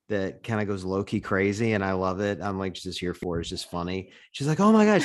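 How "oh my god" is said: imitating a fan's high, loud squeal; excited fan. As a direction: gush like a starstruck fan spotting her idol. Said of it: "She gets, like, all excited when she sees you".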